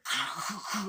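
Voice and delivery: high giggle, loud; high mock laugh. Castor's voice laughing, high mock giggle. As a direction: giggle like a villain. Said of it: "He's like".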